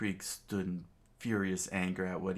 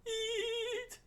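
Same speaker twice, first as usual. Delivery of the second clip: high cartoon voice. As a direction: squeak the word like a kind little cook. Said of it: "He remembered in his flashback".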